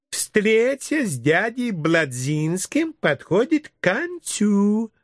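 high, very slow, drawn-out sweetness; cooing kindergarten teacher. Acted. Teacher leading her chicks to invite the guest, slow high coo. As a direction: stretch every phrase like baby talk. Story figure: sugary teacher speech.

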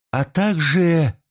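pensive, left hanging unfinished; thoughtful trailing off. A narrator's reading. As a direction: stretch it out as if lost in thought. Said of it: "Фурия задумчиво умолкла".

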